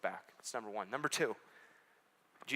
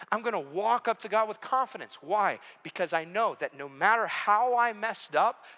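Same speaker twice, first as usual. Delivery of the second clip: louder and higher confident preaching; forceful preaching; 7 semitones higher, 6 dB louder than usual. Acted. Preacher to the church, loud and confident. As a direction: proclaim it with confidence.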